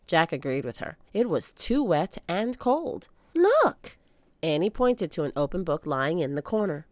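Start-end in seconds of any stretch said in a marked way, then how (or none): 3.3-4.0 high, loud excited cry. Acted. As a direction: point and cry out. Annie voiced by narrator.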